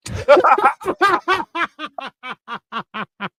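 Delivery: high, drawn-out burst of laughter; long laugh. Real, not acted. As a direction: laugh helplessly, trailing on and on.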